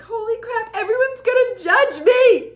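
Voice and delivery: high, loud freak-out; panicked inner voice. Retold or imitated. Coach recalling her fear of being judged, panicked high shout. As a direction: shriek it like sudden panic. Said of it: "I was like".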